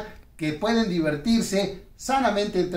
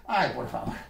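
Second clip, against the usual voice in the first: ordinary talk, nothing marked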